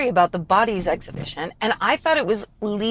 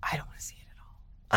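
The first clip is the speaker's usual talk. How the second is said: hushed, breathy aside; whispering; 7 semitones lower, 6 dB quieter than usual. Real, not acted. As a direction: whisper it under your breath.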